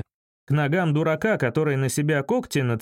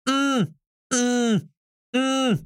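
high bleeped-out yelling; frantic high noises from the receiver; shrill caller at full strain, mimicked; straining phone voice; 9 semitones higher, much slower than usual. A narrator's reading. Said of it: "надрывалась трубка".

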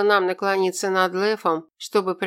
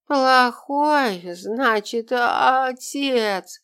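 put-on mumbled drunk grumble, higher; drunken mumbling; 6 semitones higher, much slower than usual. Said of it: "донеслось до нас бормотание". (пьяное бормотание)

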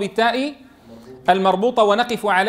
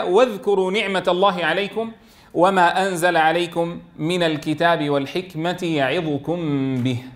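Quran recitation; 3 semitones lower than usual. Shown as a demonstration.

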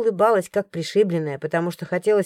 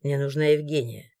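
lower, softer, firm male voice; guard interrupts; 6 semitones lower, 5 dB quieter than usual. A narrator's reading. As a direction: cut in low and firm. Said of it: "прервал её Морган". (низко и твёрдо перебивает)

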